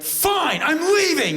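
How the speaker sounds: high, loud huffy outburst; mock-offended shout; indignant. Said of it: "He was indignant".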